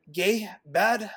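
imitating a Russian cop's broken English; Russian accent. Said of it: "in a Russian accent".

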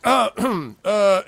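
high, loud throat-clearing stammer; acted goblin catching himself; flustered goblin. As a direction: clear your throat to recover your dignity. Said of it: "And then he's like".

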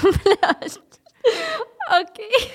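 half laugh, half sob, pitched high; real laugh-cry, high and strained; laughing and crying at once. Real, not acted. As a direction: crack up in despair. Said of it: "Боже, що не так?".

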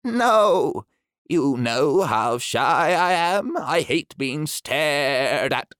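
pleading, slow and near tears; shy creature on the verge of crying; a touch of tears, drawn out slowly; close to tears. Acted. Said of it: "he almost began to cry".

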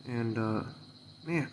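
normal talking voice

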